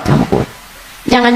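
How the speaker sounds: ordinary talk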